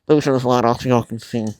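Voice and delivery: ordinary delivery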